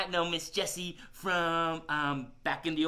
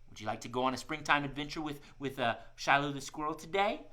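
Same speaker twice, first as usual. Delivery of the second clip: normal talking voice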